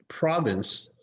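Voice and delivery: usual speaking voice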